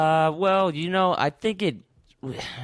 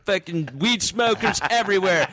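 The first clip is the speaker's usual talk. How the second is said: high-pitched impassioned mock rant; mock righteous protest; 6 semitones higher than usual. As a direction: protest like a wronged activist, slowing down. Mock rant defending weed smokers.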